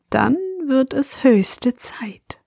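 hushed bedtime whisper. Acted. Bedtime story intro whisper.

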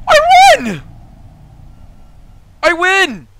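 high, very loud victory cry; real joyful shriek of winning; triumphant shout. Real, not acted. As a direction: yell it out in triumph. Triumphant win in game.

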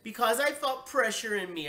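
high, loud whiny excuse; upset child's protest. A narrator's reading. Child explains herself to angry parents, protesting. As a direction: blurt it out in self-defense.

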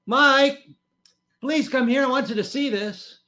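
high, loud sing-out up the stairs; wife's sweet voice. Retold or imitated. Wife calling husband downstairs to see the news, sweetly. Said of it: "her sweet little voice came wafting".

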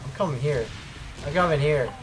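deep, soft self-talk; low mutter. Real, not acted. Gamer capturing the node alone, low mutter. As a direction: mutter the plan low and quiet to yourself. Muttering own plan in game.

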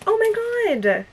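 high, drawn-out "oh my god"; thrilled high exclamation; delighted. Real, not acted. Delighted reaction to gift.

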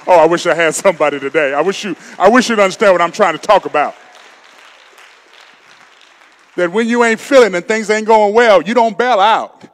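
loud, fast pleading sermon climax; preaching at full force. Acted. Preacher urging the congregation to stay committed, loud and fast. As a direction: lift the church, push it out hard.

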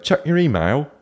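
high, lightly mocked British accent; hint of a put-on British accent; British friend. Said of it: "my British friend just texted me".

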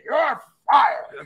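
high, loud mock boss bark; Vince McMahon impression. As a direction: fire them like a wrestling boss. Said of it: "Vince McMahon saying".

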